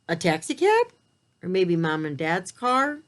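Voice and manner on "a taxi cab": much higher, bright questioning guess; eager guess. Acted. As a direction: toss out the guess with delight. Guessing excitedly for children.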